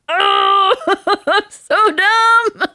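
high, loud, laughing self-mockery; laughing at herself. Real, not acted. Realising her own mistake on the call, laughing loudly and high. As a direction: crack up while calling yourself dumb.

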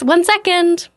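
high, polite hostess voice; mock-sweet customer service voice. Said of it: "Very nice, very polite".

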